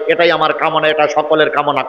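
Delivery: ordinary talk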